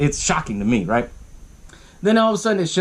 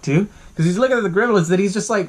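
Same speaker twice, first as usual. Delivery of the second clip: usual speaking voice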